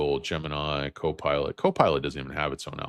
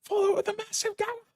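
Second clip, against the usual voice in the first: very high squeaky cartoon dog voice; squeaky hyper pup, pitched very high; [name] as a dog. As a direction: yap it out like a wired little dog. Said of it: "You should get [name] on here as dog".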